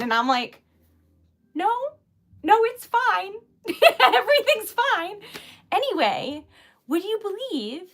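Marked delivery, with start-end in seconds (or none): 1.5-5.2 laughing reassurance. Retold or imitated. Reassuring the apologizing waiter.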